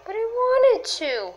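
high, whimpering crybaby voice; mock crying. Creature pleads with partner who mocks it, high mock crying whimper. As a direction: whimper like you are about to cry. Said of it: "Stop crying".

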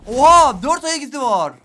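a sudden loud, high gasp of alarm; shocked. Real, not acted. Streamer spotting four enemies, shocked loud blurt. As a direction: blurt it out startled.

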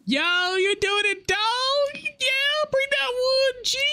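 high, slow hype-bro voice; frat bro. Acted. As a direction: hype up the boys in a squeaky bro voice. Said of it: "you gotta hype up your boys".

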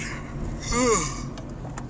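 a real early-morning yawn. Real, not acted. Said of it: "See, I'm yawning already".

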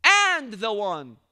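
"And the one" said slowly with a loud push; slow and emphatic. Shown as a demonstration. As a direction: lean hard on "And the one", very slow. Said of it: "Allah didn't say it".

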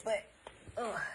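ordinary talk

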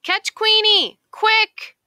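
high, loud urgent duck cry; rally everyone to give chase; urgent shout. A narrator's reading. Duck urges the other animals to chase the dog, high urgent shout.

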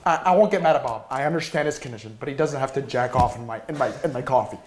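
normal talking voice

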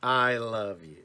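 weighty I, words falling away slowly; emphatic inflection. Shown as a demonstration. Speaker demonstrates inflections, heavy stress on I. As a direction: lean hard on I, then ease down. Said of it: "It's inflections".

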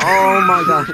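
shrieking with laughter; laughing shout. Real, not acted. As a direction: scream it through a laugh. Big hit, shouting and laughing.

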